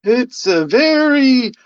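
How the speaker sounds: high, slow wailing phantom; drawn-out spooky falsetto; library ghost. Acted. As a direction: wail a warning like a silly ghost. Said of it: "I'm the ghost of the library".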